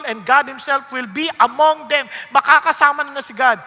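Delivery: loud, driving sermon voice; loud fiery preaching about judgment day; full-force preaching. Acted. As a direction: preach it out to the congregation.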